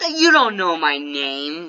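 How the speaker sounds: slow, stretched-out comeback; drawn-out retort. Retold or imitated. Kid telling a five-year-old he guessed wrong, drawn-out retort. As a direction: draw it out slowly, pointedly. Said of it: "not even rude about it".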